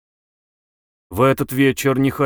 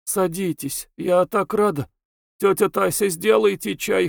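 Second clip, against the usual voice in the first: put-on sick girl voice; 8 semitones higher than usual. A narrator's reading. Narrator voices feverish girl.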